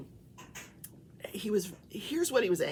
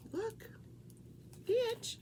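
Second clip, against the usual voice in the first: high rising call; high coaxing call; calling the pet; 12 semitones higher, 6 dB quieter than usual. Real, not acted. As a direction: sing his name up high.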